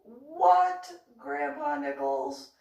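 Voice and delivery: drawn-out, insistent query; exasperated question. Retold or imitated. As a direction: demand it with mock impatience. Retold impatient question, higher, stretched.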